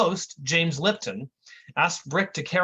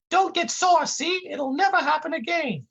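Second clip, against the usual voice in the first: much higher, meek apology; high kid voice; 13 semitones higher than usual. Acted. Fat intern apologizing to the agent, high voice. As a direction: squeak it like a clumsy boy. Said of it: "the kid says in his high voice".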